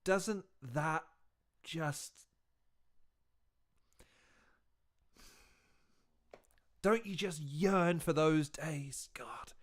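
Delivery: slow, hushed and low; wistful yearning. Acted. Reactor longing for the old crowds, wistful and hushed. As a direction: sigh it out softly, aching for lost days.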